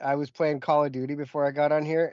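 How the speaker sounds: ordinary talk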